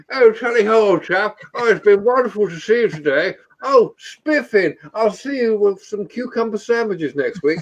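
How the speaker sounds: put-on a pipe-smoking toff in posh English accent. Mocking posh Brits for his friends in posh English accent. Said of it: "I'll get the pipe out".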